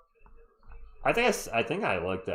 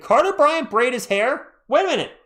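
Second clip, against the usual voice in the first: high, louder burst of disbelief; surprised double take; 12 semitones higher, 8 dB louder than usual. Real, not acted. Streamer spotting a player's braided hair, surprised double take. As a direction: react in real surprise, pitch jumping up. Spots a player's new hairdo.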